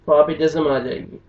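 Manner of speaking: ordinary talk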